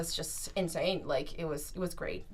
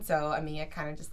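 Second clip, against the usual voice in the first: ordinary talk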